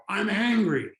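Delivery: angry outburst. Acted. Yelling customer answers what's going on, angry. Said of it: "they're gonna look at me and they're gonna go".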